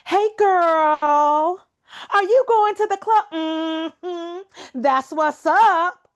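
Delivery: high, loud, exaggerated sassy voice; high and loud mock caricature; mocking media caricature.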